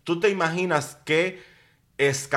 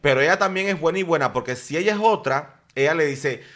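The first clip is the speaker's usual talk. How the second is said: ordinary talk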